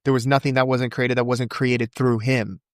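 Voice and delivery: quick, even recitation from memory; rapid-fire recitation. Shown as a demonstration. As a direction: rattle off the verse without pausing. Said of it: "You said that so fast".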